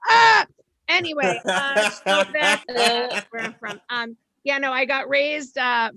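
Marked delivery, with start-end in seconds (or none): 0.1-0.5 excited yell. Acted. Excited yell after joke.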